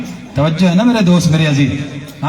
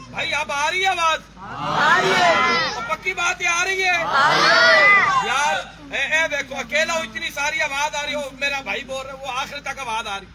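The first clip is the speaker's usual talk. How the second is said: pitched-up preaching, softer on mic; high preaching voice; 11 semitones higher, 5 dB quieter than usual. Acted. Preacher urging the crowd to answer back, pitched high. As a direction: press the crowd to match you.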